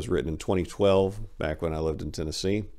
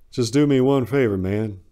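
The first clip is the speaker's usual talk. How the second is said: louder and higher, half joking; loud wry request; 5 semitones higher, 6 dB louder than usual. Acted. Patient ribbing the nurse, loud and wry. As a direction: ask the favor with a raised, wry voice.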